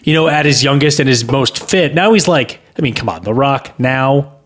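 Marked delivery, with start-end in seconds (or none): none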